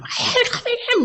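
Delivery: high falsetto whine; lightly hammed high girl voice; mock sister. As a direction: plead it like the tipsy sister. Sister quoted on phone.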